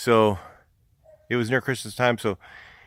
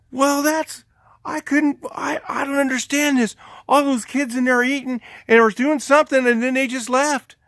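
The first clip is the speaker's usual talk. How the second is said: high, loud mock alibi; hammed-up innocent bystander; feigned innocence; 6 dB louder than usual. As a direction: play a man improvising an excuse, voice pitched way up. Man keeps up false alibi.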